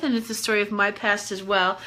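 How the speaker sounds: ordinary talk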